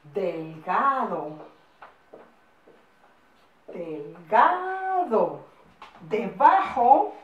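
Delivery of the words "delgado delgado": delgado drawn out very slowly, syllable by syllable; slow, careful classroom dictation. Shown as a demonstration. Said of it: "en el siguiente renglón".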